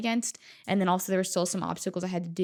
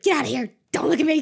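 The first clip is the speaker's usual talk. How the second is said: higher, louder playful shoo; mock shooing; 8 semitones higher, 7 dB louder, faster than usual. Runner shooing friends away, loud and higher. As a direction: wave friends off before you cry. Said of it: "tell you guys to be like".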